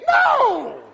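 high, loud, stretched refusal; emphatic shout. Real, not acted. Preacher rejecting patty cake prayers, shouting. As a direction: burst out with a shouted no.

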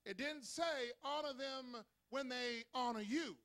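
loud, high and slow, building to a shout; preaching at full force. Acted. Pastor thundering God's command to the congregation. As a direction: drive the commandment home with rising fire.

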